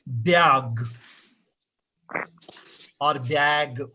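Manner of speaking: BAG said slowly as "byag"; local pronunciation of BAG. Shown as a demonstration. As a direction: slip a y-glide into BAG, "byag". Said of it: "we say bag".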